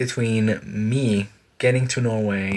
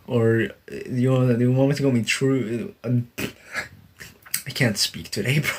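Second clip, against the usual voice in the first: normal talking voice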